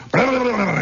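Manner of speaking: high burbling flutter, falling away; imitated flurry of beating wings; tongue-fluttered cockfight noise; wing-flapping noise. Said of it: "and the wings are".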